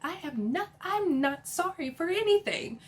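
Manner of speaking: high-pitched mock indignation, loud at the start; indignant. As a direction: act out an affronted refusal to apologise. Retakes Sutton's refusal to apologise.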